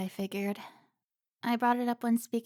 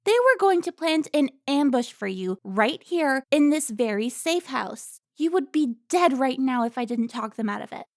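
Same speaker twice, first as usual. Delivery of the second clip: loud, higher, rising anger; furious rant; 5 semitones higher, 8 dB louder than usual. Acted. Carmen berating Alfonso for his betrayal, loud and furious. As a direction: tear into him.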